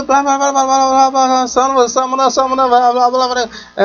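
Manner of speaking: high rant of mumbled curses; mock cussing friend in a high voice; imitating her cussing. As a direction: cuss on and on, high and heated. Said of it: "she was cussing quite strongly".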